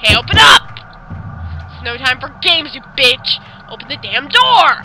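furious shout. Acted. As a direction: demand she open it, enraged. Yuta pounding the door.

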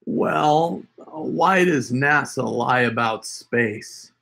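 normal talking voice

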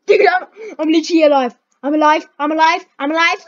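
high excited cry of relief; giddy relief. Real, not acted. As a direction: celebrate surviving. Surprised survival, giddy.

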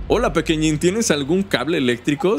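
ordinary talk

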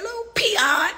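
lightly voiced grandma, high and scolding; a hint of a scolding grandma. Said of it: "Like my grandma said".

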